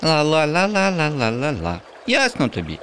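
put-on high, sing-song childlike taunt; cheeky high-pitched la-la; teasing girl. A narrator's reading. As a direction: tease him with a smile, like a little girl. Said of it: "усміхнулась дівчинка".

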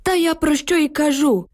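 put-on old man exclaiming emotionally. A narrator's reading. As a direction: burst out insistently. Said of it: "емоційно вигукнув дід".

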